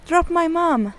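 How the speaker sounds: high, firm yell. A narrator's reading. Hilary yells at the bad guys, high and firm. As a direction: order them to let go. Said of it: "Hilary yelled".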